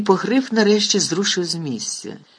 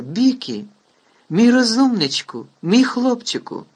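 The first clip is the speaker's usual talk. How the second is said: louder, higher tearful praise; tearful tenderness; 8 semitones higher, 4 dB louder than usual. A narrator's reading. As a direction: gush over him through tears. Hagrid crying to his pet.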